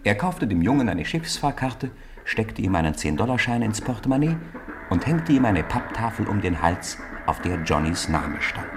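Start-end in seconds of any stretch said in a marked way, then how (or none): none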